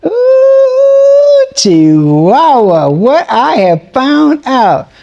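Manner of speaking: high ooh into slow, stretched words; swooping, drawn-out amazement; excited teaser. Real, not acted. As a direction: drag it out, bursting with news. Hyped opener teasing news.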